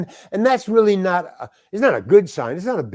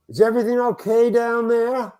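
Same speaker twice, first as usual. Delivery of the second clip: calling over; 7 semitones higher than usual. Retold or imitated. Director calling to the script girl from afar, calling over. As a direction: call across the set to check in.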